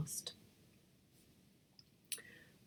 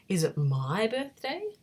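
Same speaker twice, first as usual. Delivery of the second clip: hopeful; 5 semitones higher, 6 dB louder than usual. A narrator's reading.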